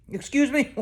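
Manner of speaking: high, outraged "excuse me"; a high mock-offended "Excuse me?"; mock indignation. Real, not acted. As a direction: act offended by the joke. Indignant reaction to producer joke.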